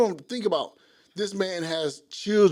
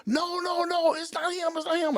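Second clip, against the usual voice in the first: high mock scream, held back in volume; urgent high-pitched pleading; retold screaming; 10 semitones higher, slower than usual. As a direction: imitate girls crying out in protest. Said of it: "they started screaming".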